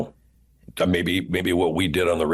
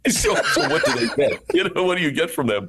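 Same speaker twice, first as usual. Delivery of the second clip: laughing; 7 semitones higher than usual. Acted. Laughs as character.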